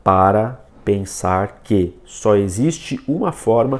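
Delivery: slow, halting read, a pause after each word. Shown as a demonstration. Teacher shows viewers the learned way of reading, word by word. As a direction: read it in separate, labored chunks. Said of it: "Eu tô lendo da forma que eu aprendi".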